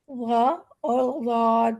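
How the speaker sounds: very slow, drawn-out غ اور ضاد; distinct letter sounds. Shown as a demonstration. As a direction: say غ and ضاد slowly, each from its own point. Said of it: "یہ چاروں مخارج مختلف ہیں".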